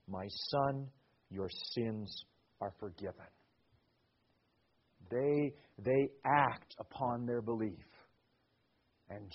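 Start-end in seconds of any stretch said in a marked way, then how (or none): 0.1-3.3 soft, low and gentle forgiveness; tender Jesus. Acted. Jesus tenderly forgives the paralysed man, soft and low. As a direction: lay the words down softly, like a blessing.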